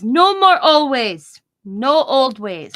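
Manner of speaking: loud, drawn-out no-nonsense doctor; doctor impression. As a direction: stretch each word, falling firmly at the end. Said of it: "And that's the way he talks".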